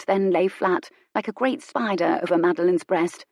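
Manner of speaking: plain reading voice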